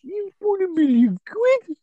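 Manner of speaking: high, slow mock Brummie; Brummie accent. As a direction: draw it out in a squeaky Birmingham caricature. Echoes the mock Brummie joke.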